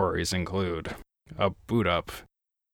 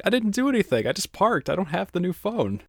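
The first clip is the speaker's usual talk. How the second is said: incredulous; 11 semitones higher, faster than usual. Retold or imitated. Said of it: "And I was like".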